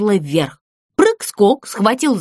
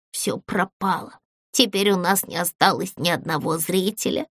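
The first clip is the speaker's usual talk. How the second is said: low, hushed, defeated voice; despairing; 4 semitones lower, 7 dB quieter than usual. A narrator's reading. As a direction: say it softly, all hope lost. Character despairs before bursting into wailing. (тихо, убито)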